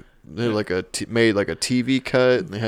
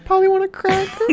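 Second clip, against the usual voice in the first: squawky parrot voice, much higher and louder; 5 dB louder than usual. Retold or imitated. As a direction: squawk it like a talking bird. Voicing the kid with the bird.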